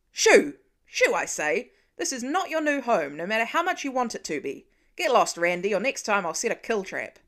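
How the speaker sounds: loud, exasperated shoo at a pest; shooing yell. Acted. Clint yelling at the raccoon in the barn, loud and fed up. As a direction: drive the raccoon off with a shout.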